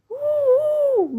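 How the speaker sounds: high hooting bird call; bird call imitation. A narrator's reading. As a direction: hoot like a hidden bird. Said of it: "Bean said out of the side of her mouth".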